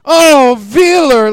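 slow, loud drawn-out mock moan; hammed-up moan. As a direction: stretch it out like a cheesy love scene. Linka moaned.

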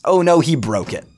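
loud, high playful outcry; mock dismay. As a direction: act shocked that a rule was broken. Mock dismay at the broken pattern.

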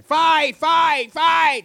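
very loud, high chant; crowd yelling. Acted. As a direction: yell it like a crowd. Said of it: "Everybody in the village started yelling".

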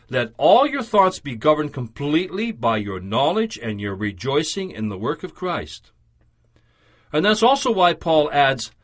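ordinary delivery

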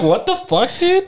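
high-pitched mock disbelief; retold incredulity. Friend recapping an anime reveal, incredulous.

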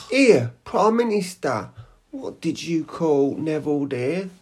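high, slow, questioning voice; put-on character voice. Character questioning the Prime Minister, high put-on voice. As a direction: pitch it up and draw the question out.

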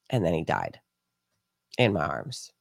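telling a friend the chicken died, subdued; sad, hushed. Real, not acted. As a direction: let the loss sink in. Chicken dies in her arms.

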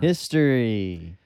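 "History." stretched slow and savored; drawn-out "History.". Real, not acted. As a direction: linger on "History." with relish. Savoring his favorite topic.

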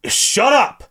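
high-pitched mock snapping; exasperated retort. Geralt telling the unseen ghost off, exasperated. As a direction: cut them off, pitch jumping up.

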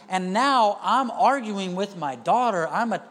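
usual speaking voice

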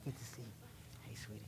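whispered greeting to a latecomer; whispered aside. Real, not acted. Pastor greeting a child mid-sermon, whispered. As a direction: breathe it off-mic.

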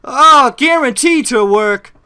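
high, slow, blissful ad sigh; chipper 1950s ad. Acted. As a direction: savour the relief like an old commercial. Fake ad satisfied customer.

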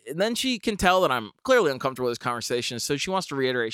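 ordinary delivery

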